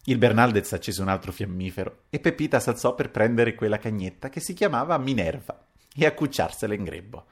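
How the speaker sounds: plain reading voice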